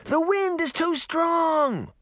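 put-on high, slow boy voice. A narrator's reading. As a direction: drag it out against the wind. Puck answering in the storm.

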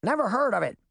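high-pitched bird squawk; brisk, high dodo voice. A narrator's reading. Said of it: "the Dodo squawked".

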